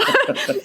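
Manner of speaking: a short, high-pitched giggle; high laugh. Real, not acted. Laughing at herself.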